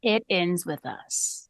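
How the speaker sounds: slow, hushed title echo; drawn-out ominous echo; slow dramatic echo. Real, not acted. Echoing the title slowly.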